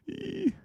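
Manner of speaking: tiny very high embarrassed squeal; a quick cringing squeak; high-pitched "eee" of embarrassment. Real, not acted. Embarrassed reaction after forgetting names.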